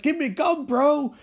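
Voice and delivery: high-pitched bro-style demand; hammed-up action-hero plea; mock urgent demand. Acts out Eddie demanding a gun.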